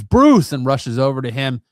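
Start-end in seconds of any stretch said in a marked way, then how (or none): 0.0-0.5 high, loud frightened cry of a name; scream. Retold or imitated. Diana seeing Bruce struck by the arrow, screaming. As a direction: scream his name as he falls. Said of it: "Diana screams".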